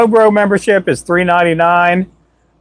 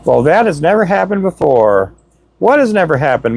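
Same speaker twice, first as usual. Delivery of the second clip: ordinary talk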